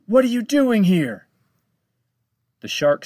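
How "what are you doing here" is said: alarmed shout. Acted. Frightened captain shouts at shark.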